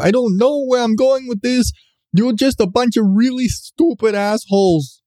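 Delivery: high, mock-indignant rant; outraged like a wounded clown; angry laughter. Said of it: "mock outrage at criminals".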